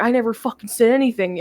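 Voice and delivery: ordinary delivery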